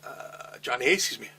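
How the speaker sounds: ordinary talk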